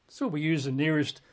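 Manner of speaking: ordinary talk, nothing marked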